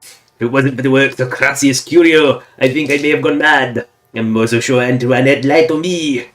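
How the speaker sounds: put-on French accent reading a husband's journal; terrible fake French accent. Gamer reads Pierre's journal aloud, terrible fake French accent. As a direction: read it in a badly faked French accent. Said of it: "my terrible fake French accent".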